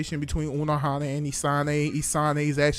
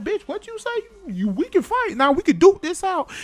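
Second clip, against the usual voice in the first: much higher, picking a fight; challenging to fight; 12 semitones higher than usual. Retold or imitated. Soifon challenging a fellow captain to a fight, high and combative. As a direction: dare them to fight, pitched way up. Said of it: "Can't say like".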